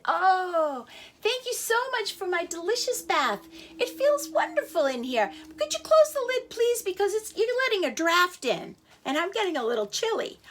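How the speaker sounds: high, sweetly innocent thanks; a chick politely asking for the lid closed; cheeky chick. Acted.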